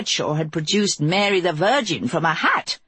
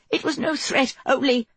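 high panicked voice; panicky; 9 semitones higher than usual. Acted. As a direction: blurt in panic. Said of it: "in a high, panicky voice".

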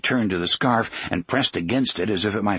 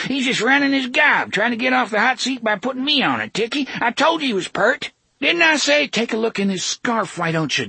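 high rough thug voice; 13 semitones higher than usual. A narrator's reading. Gasher's high voice.

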